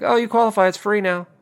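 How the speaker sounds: ranting traveler mocks the site's sudden approval; mock cheerful website. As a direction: chirp it like a fake-friendly app.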